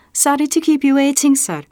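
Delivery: high, quick little monkey voice; monkey scholar. Acted. Monkey in a mortar board apologising to Bastian, high and quick. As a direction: hurry on like a busy little professor.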